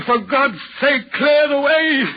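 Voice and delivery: slow, rising spectral plea; ghostly plea. Retold or imitated. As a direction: call it out like a phantom begging. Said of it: "He seems to be trying to say".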